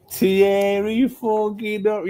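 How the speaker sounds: high, slow, drawn-out hype; hyped exclamation. Real, not acted. Streamer hyping a chat nickname, drawn out high and slow. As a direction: savour every syllable of the name.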